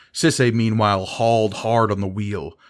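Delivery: ordinary delivery, nothing marked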